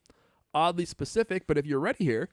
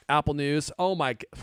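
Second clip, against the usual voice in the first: ordinary delivery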